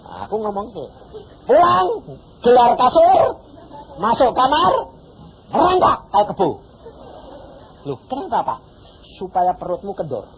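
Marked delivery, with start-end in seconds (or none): 1.5-6.6 high, loud, emphatic orders; loud instruction. Retold or imitated. Preacher ordering the pregnant woman to crawl home, loud and emphatic. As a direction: bark out the odd instructions.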